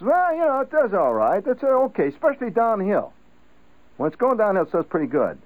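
a shrug about his new Mercury; Zudak. Retold or imitated. Zudak explains his gas mileage.

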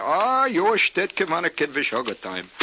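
high, loud Hungarian greeting; mock Hungarian. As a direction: greet grandly in fake Hungarian. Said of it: "smatterings of Hungarian".

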